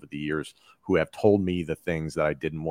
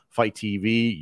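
normal talking voice

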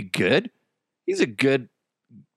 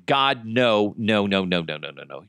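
ordinary delivery

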